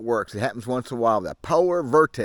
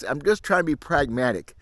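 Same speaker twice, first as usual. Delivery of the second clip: ordinary talk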